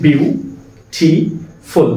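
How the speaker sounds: Beautiful broken slowly into বিউ, টি, ফুল; slow three-beat বিউ – টি – ফুল for beautiful; syllable by syllable. Shown as a demonstration. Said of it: "এটা হচ্ছে ট্রাইসিলেবল".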